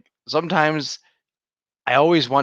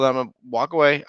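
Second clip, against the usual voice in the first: usual speaking voice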